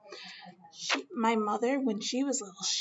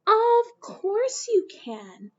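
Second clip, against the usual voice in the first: slow, higher and reassuring; encouraging mother; 9 semitones higher, 6 dB louder, much slower than usual. Acted. Mother reassuring little Mae she can do it, slow and encouraging. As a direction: stretch it out to comfort a child.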